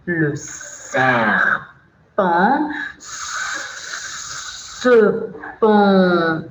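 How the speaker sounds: slow, drawn-out hissed S sounds; hissing recitation. Shown as a demonstration. Teacher reciting the snake verse to children, slow hiss. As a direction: stretch the S like a snake, very slowly. Acts out the snake's poem line.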